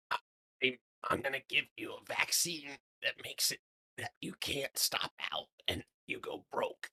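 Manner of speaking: whispering. Pretend. Joking aside to chat, whispered.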